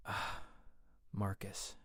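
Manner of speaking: hushed, tender whisper of his name. A narrator's reading. Queen to the man who just confessed, whispered. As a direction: whisper it, barely any voice. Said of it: "she whispered".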